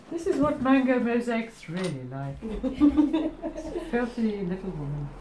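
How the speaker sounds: plummy upper-class English voice; posh voice. Pretend. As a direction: put on a haughty posh accent. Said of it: "Where's the posh voice come from all of a sudden?".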